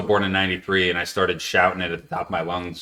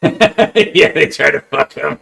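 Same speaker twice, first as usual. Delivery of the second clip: laughing; 7 semitones higher, 7 dB louder, much slower than usual. Real, not acted. Laughing recounting episode.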